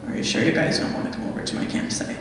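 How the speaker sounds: imitating a menacing stranger's hushed ask; creepy deep voice. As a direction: murmur it like a creepy man just outside the car. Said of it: "deep voice at the end".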